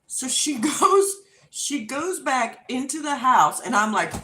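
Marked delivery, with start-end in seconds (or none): none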